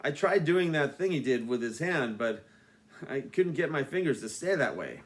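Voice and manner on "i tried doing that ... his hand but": ordinary delivery, nothing marked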